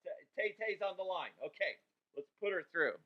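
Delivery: fast, hushed producer aside; pretend producer. Host plays his own producer putting the call through, quick hushed aside. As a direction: rattle it off like a quiet producer. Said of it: "I'm pretending to talk to a producer".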